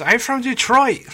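high, loud mock line delivery; imitating character. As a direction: squeal it out like a caught-out actor. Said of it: "he says".

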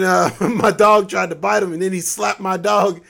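ordinary talk

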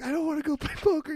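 mock crying whine. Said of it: "started crying".

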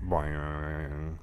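low twangy boing imitating mic; springy boing sound. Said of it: "making the boing noise again".

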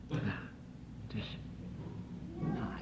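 ordinary delivery

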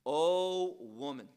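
slow, weighty O woman; emphatic address. Acted. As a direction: call out O woman slowly, with full weight. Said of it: "that's emphatic".

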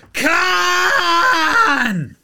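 high, long drawn-out announcer cry; dramatic shout. Acted. Host revealing his favourite old mate no mates, drawn-out shouted reveal. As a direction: stretch the name out like a reveal.

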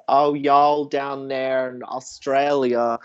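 put-on slow, drawn-out Indiana accent; put-on American drawl, stretched out slowly; imitating friend's American accent. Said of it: "he's got that sort of accent".

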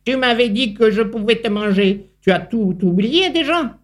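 a touch of spiteful anger; cheated spider grumbling at the runaway ant. A narrator's reading. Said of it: "ronchonna l'araignée de dépit et de colère".

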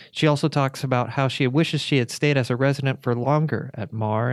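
scripted voice-over read; podcast narration. Real, not acted. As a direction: read it as an informative narrator between interview segments. Host reads a recorded narration segment.

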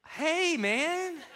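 high, laughing hey man; playing it off. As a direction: cover the mistake with a giggly greeting. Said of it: "I had to play this off".

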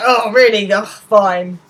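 a put-upon groan of a reply; stroppy. Retold or imitated. Simon sulking at his mum asking to leave, stroppy. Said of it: "really stroppy".

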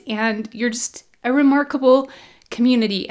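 normal talking voice

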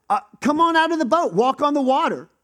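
calling out to Peter on the boat; Jesus calling out. Acted. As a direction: beckon him out of the boat.

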